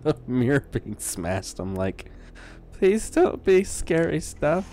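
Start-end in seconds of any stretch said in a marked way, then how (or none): 2.7-4.7 high, loud, drawn-out pleading; mock-scared plea. Player begging the game not to scare him, mock-scared. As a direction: beg slowly in a high, worried voice.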